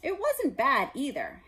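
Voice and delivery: plain reading voice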